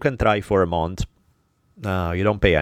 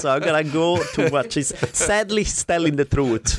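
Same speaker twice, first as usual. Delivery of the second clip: laughing; 7 semitones higher than usual. Real, not acted. Still laughing after the joke.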